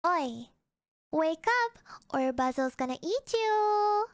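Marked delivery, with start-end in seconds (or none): none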